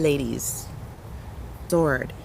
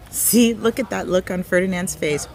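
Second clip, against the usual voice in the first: ordinary delivery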